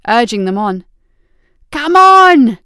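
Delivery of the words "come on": loud effortful yell; straining shout. A narrator's reading. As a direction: shout while heaving hard. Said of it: "Hemet was grunting with each push, urging them on".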